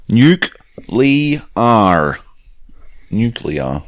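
"nuclear" broken into slow syllables, nuke-lee-ar, then said whole; sounded-out nuclear. Shown as a demonstration. Podcast host sounding out a listener's postscript, "nuclear" slow and deliberate. Spelling out the correct word.

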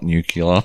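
"nuclear" mimicked as nuke-yuh-ler; imitated mispronunciation. Host mimicking his co-host's mistake, "nuclear" as nuke-yuh-ler. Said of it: "not nuclear".

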